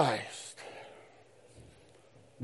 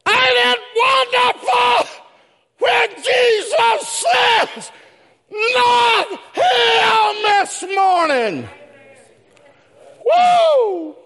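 high, shouted gospel preaching; slow-building, high-pitched revival shout; full-force preaching; slower than usual. Real, not acted. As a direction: belt it out to the whole church.

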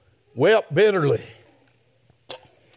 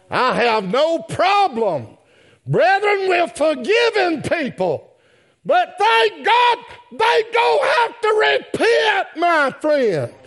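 preaching at full force; 11 semitones higher than usual. Acted. Preacher to brethren, crying out that sinners must repent. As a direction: leap up into a preacher's cry.

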